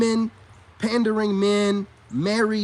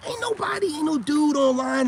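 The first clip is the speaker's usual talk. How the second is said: mocking high voice; 6 semitones higher than usual. Mocking online dudes.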